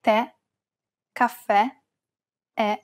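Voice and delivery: Tè, caffè, è with an open, stressed final E. Shown as a demonstration. Host shows grave accent words, open stressed E in Tè, caffè, è. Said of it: "pronounce it as an open E".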